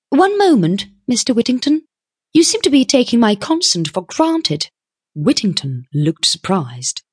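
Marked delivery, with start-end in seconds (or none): 0.1-4.7 high-pitched, firm interruption; objecting young woman. A narrator's reading. Young woman halts the businessman's presumption, objecting. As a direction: pull him up short, pitched high.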